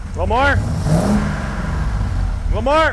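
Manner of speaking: calling out. Real, not acted. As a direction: shout instructions over a revving engine. Calling out over running engine.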